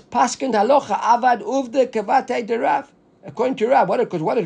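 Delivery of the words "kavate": usual speaking voice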